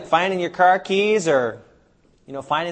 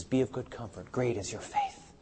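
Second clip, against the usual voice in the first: tender quote; 3 semitones lower, 10 dB quieter than usual. Acted. Jesus speaking to the woman.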